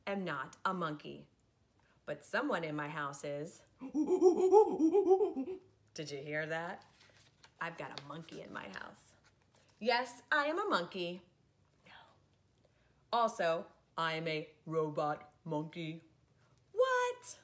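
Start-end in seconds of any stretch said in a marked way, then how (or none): 3.8-5.6 loud, high cackling monkey laugh; hammed-up monkey giggle; silly monkey laugh. 16.7-17.4 high, loud squeal of surprise; a hammed-up startled "what?"; mock shock. Mock shock at robot monkey.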